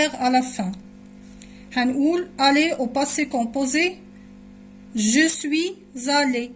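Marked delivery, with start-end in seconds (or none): 4.9-6.5 "suis allé" linked with a z, slowly; slow "Je suis allé", z carried into "allé"; with liaison. Shown as a demonstration. Teacher conjugating aller for students, slow liaison z in "suis allé". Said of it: "بنعمل لا liaison".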